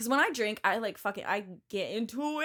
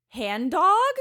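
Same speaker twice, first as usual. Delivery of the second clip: high, loud call of Hand dog; 7 semitones higher, 8 dB louder than usual. Retold or imitated. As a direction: call out across the patio. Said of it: "all of a sudden I hear".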